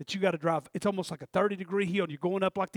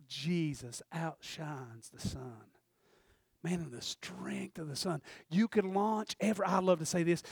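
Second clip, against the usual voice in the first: normal talking voice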